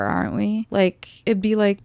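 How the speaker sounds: ordinary delivery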